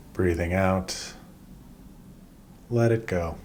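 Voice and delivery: calm, unhurried body-scan guidance with long pauses; measured meditation-teacher voice, leaving space after each instruction; guided meditation. Acted. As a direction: guide them gently through breath and body, phrase by phrase.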